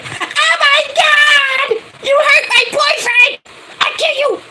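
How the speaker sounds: shrieking falsetto imitation of an outraged girlfriend; mock-hysterical scream, very high and drawn out. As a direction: wail it like a girlfriend out for revenge. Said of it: "she would've freaking went".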